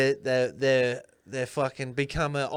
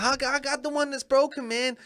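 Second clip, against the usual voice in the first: higher, panicked Chris Tucker voice; a hint of Ruby Rhod panicking; panicky Ruby; 7 semitones higher than usual. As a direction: freak out like Ruby. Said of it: "he's like".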